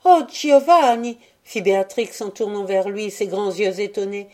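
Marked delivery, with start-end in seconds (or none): 0.1-1.2 high, loud, shocked cry; stricken, pitched-up appeal; terrified exclamation. A narrator's reading. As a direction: cry out to him in alarm. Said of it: "dont la violence l'avait terrifiée".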